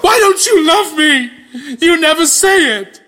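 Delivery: high, loud hammed-up whine with a laugh; mock whining. Narrator complaining to the announcer, mock whining. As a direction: play the wounded lover for laughs.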